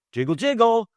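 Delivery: high, loud door-handle jiggle; door jiggle sound. Retold or imitated. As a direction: mimic a rattling door handle.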